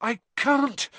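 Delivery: breathless high cry; panting. Acted. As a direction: gasp it out while sinking. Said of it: "Harry panted".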